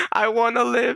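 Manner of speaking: high, wailed plea; tearful Robin imitation. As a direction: cry it out like a desperate character. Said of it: "She's making the Robin face".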